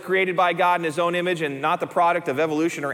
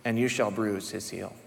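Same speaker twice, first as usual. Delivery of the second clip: lower, softer, hushed; quiet; 9 semitones lower, 7 dB quieter than usual. Shown as a demonstration. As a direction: let it fall away softly. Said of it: "Right here".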